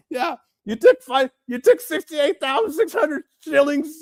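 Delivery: high mock woman's voice; mocking her. Mocking her shillings claim to the chat, in a high voice.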